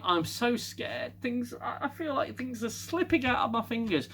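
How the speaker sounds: high-pitched imitation of fretting; mock scared. Crew member confessing her fear, mimicked in a high mock-scared voice.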